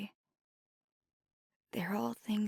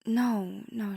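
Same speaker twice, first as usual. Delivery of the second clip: quick denial; 3 semitones higher, 8 dB louder than usual. Acted. Quickly denies the partner's worry.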